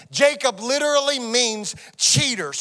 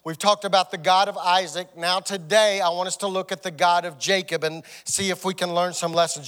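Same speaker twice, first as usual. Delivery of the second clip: ordinary talk, nothing marked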